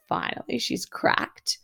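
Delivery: hushed whisper, high, as if sharing a secret; conspiratorial whisper of relief; whispered aside. Retold or imitated. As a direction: breathe it out to a colleague. Facilitators' silent look, retold.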